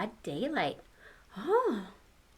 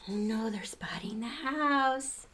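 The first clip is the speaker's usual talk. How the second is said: whispered worry. Real, not acted. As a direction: hush them as danger comes near. Reader's tense aside.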